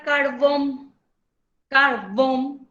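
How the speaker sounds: deliberate "carbón", stressed as car-BÓN; stress on last syllable. Shown as a demonstration. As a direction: say "carbón" twice, leaning on BÓN. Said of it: "se carga también en la última sílaba".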